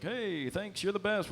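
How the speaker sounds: soft, high-pitched thanks; wife's voice. Retold or imitated. Commentator reading his wife's grateful text, soft high voice.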